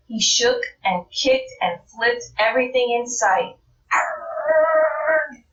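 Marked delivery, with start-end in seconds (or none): 3.9-5.4 higher mock frustrated growl; frustrated arrgh. Rabbit kicks everything in sight, a frustrated arrgh. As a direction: let out a cartoon groan.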